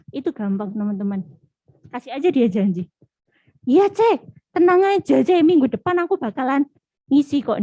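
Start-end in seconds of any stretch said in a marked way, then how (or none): 3.7-7.6 higher, louder eager promise; eager reassurance. Acted. Friend assuring a pal they will serve next week, eager. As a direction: promise it loudly and breezily. Acting out someone making promises.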